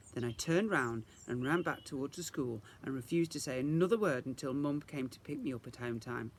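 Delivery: usual speaking voice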